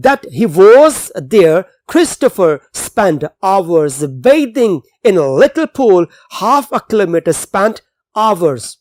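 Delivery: slow, deliberate passage reading for students; slow reading aloud. Acted. Teacher reading the English passage to the class, slow and clear. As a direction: read the text slowly so the class can follow.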